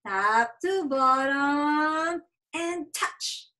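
slow, drawn-out modeling voice; slow stretched demo. Shown as a demonstration. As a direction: stretch each word slowly for a child.

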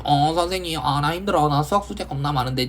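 a light put-on whine; whiny student. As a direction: play a whining kid complaining to the teacher. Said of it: "징징징, 징징징 하잖아".

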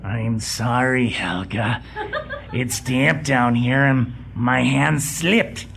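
very low, slow, sheepish mumble; lumbering henchman's apology; meek henchman. Acted. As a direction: cower and apologize.

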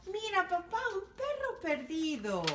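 put-on high little girl voice; a small girl excitedly pointing; child telling her father about a lost dog. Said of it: "una niña habló".

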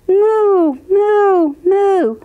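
loud high mooing cow. Acted. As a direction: moo like a cow. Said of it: "The duck on the pond said".

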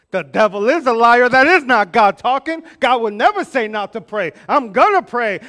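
loud, fired-up refusal; indignant outburst. Retold or imitated. Pastor retelling how he rejected the voice, loudly indignant. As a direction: push back hard with mock outrage. Retold indignant reply to God.

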